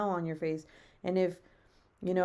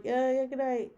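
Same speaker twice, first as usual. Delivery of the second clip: awkward good night; 6 semitones higher, 5 dB louder than usual. Retold or imitated. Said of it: "I'm like".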